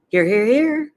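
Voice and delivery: high, loud rapid-fire honk; goose. A narrator's reading. Goose answering roll call, high and loud. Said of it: "said the goose".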